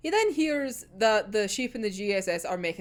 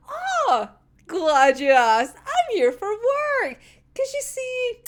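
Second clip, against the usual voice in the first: smug Dazai; 11 semitones higher, 4 dB louder, slower than usual. Retold or imitated. Dazai voiced smugly.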